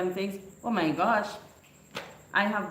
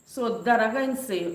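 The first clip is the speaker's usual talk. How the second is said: ordinary delivery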